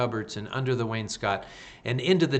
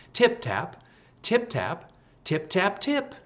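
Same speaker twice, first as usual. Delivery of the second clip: high, light tip-tap sounds, climbing higher; tapping chorus; 8 semitones higher than usual. A narrator's reading. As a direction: tap it out like tiny mice, higher each time.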